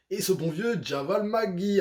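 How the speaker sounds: high, loud, drawn-out player intro; fond hyped announcement. Real, not acted. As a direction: savour the name like a ring announcer. (présentation enjouée, fort et en traînant)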